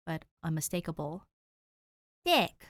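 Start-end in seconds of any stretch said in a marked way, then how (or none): 2.2-2.7 cool. A narrator's reading. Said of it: "she replies coolly".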